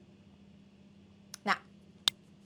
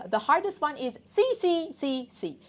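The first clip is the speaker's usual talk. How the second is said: usual speaking voice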